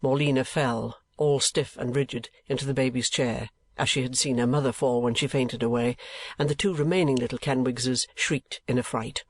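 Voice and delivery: plain reading voice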